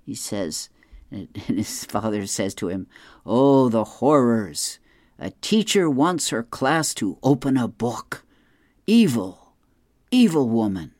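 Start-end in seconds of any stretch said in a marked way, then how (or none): 3.2-10.9 mock horror. Dad mocking son's complaint.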